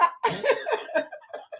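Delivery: laughing hard at a viewer's story; high burst of laughter. Real, not acted. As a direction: break into helpless laughter.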